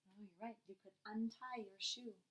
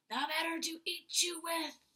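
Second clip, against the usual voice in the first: wolf voice; 6 semitones higher, 7 dB louder than usual. Acted. Reading wolf's line.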